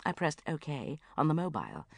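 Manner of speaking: usual speaking voice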